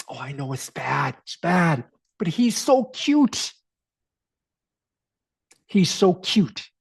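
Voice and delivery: imitating a tipsy woman gushing; giddy woman. Woman excusing the bride.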